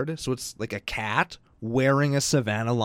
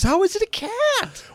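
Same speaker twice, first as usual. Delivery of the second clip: incredulous; 3 dB louder, slower than usual. Real, not acted. Baffled by a card with antlers.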